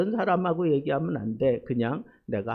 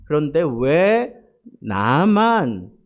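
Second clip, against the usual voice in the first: louder, drawn-out "why only me" protest; grumbling at God; 5 dB louder, slower than usual. Retold or imitated. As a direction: imitate an aggrieved prayer, stretching the words out. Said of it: "막 투덜거리고 막 짜증을 내고".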